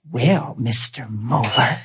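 low, soft, slow cartoon villain; sinister nurse. Nurse greeting her patient, mock-sinister voice. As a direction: draw it out like a sinister character. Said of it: "she looked to me like sinister".

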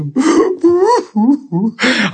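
high mock sobs; hammed-up weeping in bursts; imitated crying. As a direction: act out pitiful boo-hooing. Imitates crying while dragging himself.

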